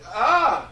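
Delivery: short, high, loud mock shriek; a hammed-up shocked squeal. Host acts out the accuser with a loud mock shriek. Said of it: "And when she said".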